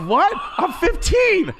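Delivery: high, yelled comic protest; incredulous shout. Retold or imitated. Teenage son protests to his dad, incredulous shout. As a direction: yell back in disbelief.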